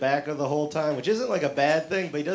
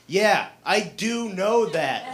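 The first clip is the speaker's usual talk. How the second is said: higher, louder, slow and defiant; louder mock yell at a tough guy; yelling back; 7 semitones higher, 3 dB louder, slower than usual. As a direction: shout your weakness at him like a boast. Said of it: "So I yell back at him".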